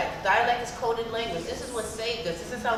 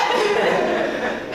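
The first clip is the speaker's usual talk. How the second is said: laughing; 9 semitones higher, 6 dB louder than usual. Real, not acted. Laughing after quote.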